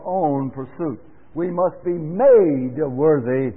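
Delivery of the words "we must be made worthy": made slowed and loud; stress on made. Real, not acted. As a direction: lean on made. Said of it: "I will emphasize one word here".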